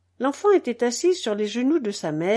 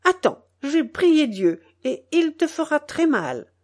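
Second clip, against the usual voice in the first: put-on higher-pitched little girl's warning; childish threat; 5 semitones higher than usual. A narrator's reading. Little girl threatening a nettle that stung her, menacing. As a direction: threaten like a small, earnest child. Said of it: "Menaçant la plante".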